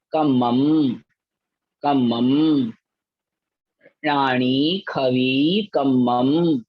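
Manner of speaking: each Prakrit word said slowly twice, for the class to repeat; a teacher's model pronunciation; slow word-by-word recitation. Shown as a demonstration. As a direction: recite word by word, clearly, pausing after each. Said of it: "पहले उच्चारण करें।".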